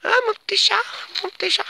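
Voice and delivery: very high pet-talk coo; cooing to cat. Real, not acted. Talking to her cat.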